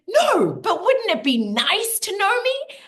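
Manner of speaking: high, bright teasing comeback; playful high retort. Retold or imitated. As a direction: sing it up high with cheek.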